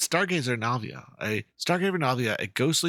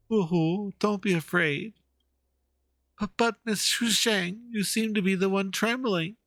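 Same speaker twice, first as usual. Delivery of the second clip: nervous voice; 8 semitones higher, slower than usual. Acted. Voicing timid character dialogue.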